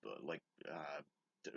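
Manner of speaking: normal talking voice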